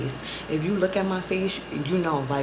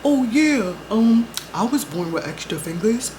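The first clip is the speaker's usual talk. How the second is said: mocking the boyfriend; 2 semitones higher than usual. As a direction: shrug it off like he did. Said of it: "this motherfucker said to me".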